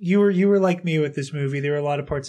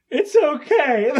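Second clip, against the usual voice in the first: unconvincing comfort; 9 semitones higher, 5 dB louder, much slower than usual. Retold or imitated. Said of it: "it was not very convincing".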